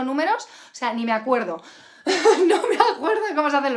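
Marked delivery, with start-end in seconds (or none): 2.0-3.7 real laugh breaking into the words; higher, louder, quick laughing confession. Real, not acted. Teacher admitting she forgets numbers, laughing as she talks.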